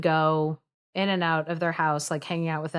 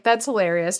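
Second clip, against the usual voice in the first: normal talking voice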